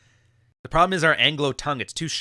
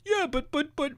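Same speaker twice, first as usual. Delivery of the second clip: much higher, sputtering buts; mock stammer; 12 semitones higher than usual. Mocking a flustered guy with no comeback.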